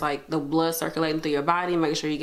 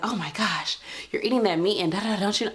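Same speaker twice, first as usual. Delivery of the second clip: nosy judger; 3 semitones higher than usual. Retold or imitated. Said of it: "be like".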